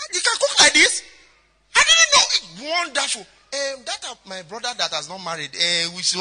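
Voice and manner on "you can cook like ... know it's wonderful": squealing, much higher and louder praise; amazed gushing. Acted. A wife praising the girl's cooking, amazed squeal. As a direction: gush like you can't believe it.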